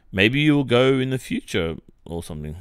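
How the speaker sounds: usual speaking voice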